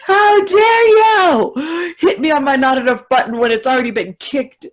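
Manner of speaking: high loud hammed-up outrage; mock outraged shout. Snapping at Dana for pushing her button, in loud mock outrage. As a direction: shriek it like a cornered diva. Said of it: "And I'm like".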